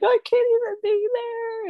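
very high, crying imitation; squeaky, put-on sobbing voice; mock tearful wail. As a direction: wail it like overwhelmed bawling. Acting out tearful reaction.

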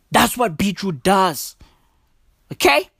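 normal talking voice

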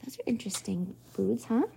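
soft aside whisper. Real, not acted. Reader's aside to children.

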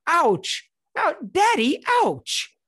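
loud, high cries of ouch; imitated hurt child. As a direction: mimic a little kid calling out to dad. Imitating hurt child crying out to dad.

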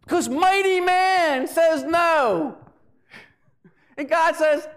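high, loud preacher's proclamation; full-force preaching. Real, not acted. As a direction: proclaim it to the whole church.

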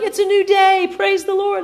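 very high, chirpy bird voice; hammed-up chirpy little bird. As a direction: sing it out like a cheerful morning bird. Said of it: "They're like".

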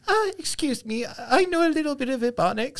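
very high, thin old-lady impression; old Southern meemaw. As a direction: pipe up high like a polite little granny.

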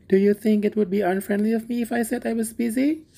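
high, timid character voice, rising at the end; shy and timid. A narrator's reading. Hans fears refusing his friend the miller, shy and timid. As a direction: ask it shyly, pitched well up. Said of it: "in a shy and timid voice".

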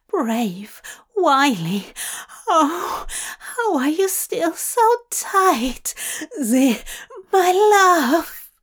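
breathy, high, gasping ardour; overcome endearments. A narrator's reading. Angel praising his sweet demon, breathless and overcome. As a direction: let the pitch climb as if overwhelmed.

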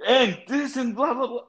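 high-pitched mock rant, tossed off; squeaky blah-blah ranting. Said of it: "I'm like, go back to that person".

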